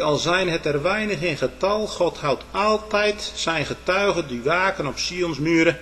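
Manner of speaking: ordinary delivery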